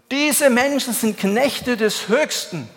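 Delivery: high, slow calling out; crying out. Acted. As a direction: call after them slowly and high. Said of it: "und rief und schrie".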